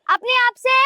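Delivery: high, disbelieving question; incredulous echo. Acted. Daughter-in-law challenges mother's talking-to-herself excuse, incredulous echo.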